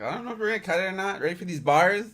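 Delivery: hyped-up quote. Retold or imitated. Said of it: "I was like".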